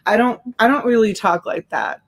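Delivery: usual speaking voice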